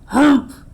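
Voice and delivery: a sulky bear's humph; grumpy humph. Acted. Storyteller voicing sulking Bear: grumpy humph.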